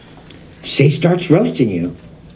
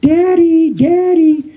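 put-on girlfriend calling; much slower than usual. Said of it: "All of a sudden, I hear".

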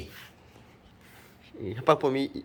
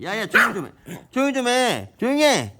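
yelling to hush; 9 semitones higher than usual. Real, not acted. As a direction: shout the dog down. Yelling at the barking dog. (높고 크게 조용히 하라고 외침)

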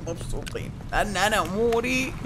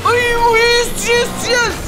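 high, loud, slow cheer of triumph; drawn-out cheer; 7 dB louder, slower than usual. Real, not acted. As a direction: stretch each yes in victory. Cheering a success. (هتاف فرح ممطوط)

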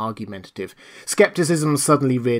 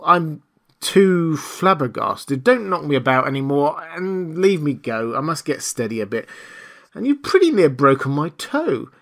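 higher, pleading complaint; flabbergasted tramp; 5 semitones higher, 3 dB louder than usual. A narrator's reading. Marvel begs the invisible man to let him go, flabbergasted. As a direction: protest shakily, overwhelmed.

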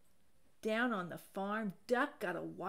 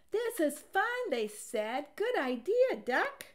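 much higher, louder and gleeful; excited cheer; 8 semitones higher, 6 dB louder than usual. A narrator's reading. The animals cheering Duck for his idea. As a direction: cheer it out happily.

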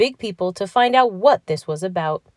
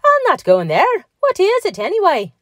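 high, loud, swooping refusal; alarmed protest; 13 semitones higher, 5 dB louder than usual. A narrator's reading. Patrick refuses to board the strange glass bubble, alarmed protest. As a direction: balk and demand an explanation. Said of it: "he cried in alarm".

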